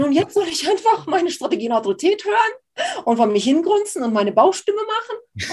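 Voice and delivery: höher, mit gespieltem Jammern, fassungslos; äff ihren Unglauben weinerlich nach; ungläubig nachgeäfft. Retold or imitated. Imitiert ungläubige Intellektuelle. (höher gespieltes, weinerliches Jammern)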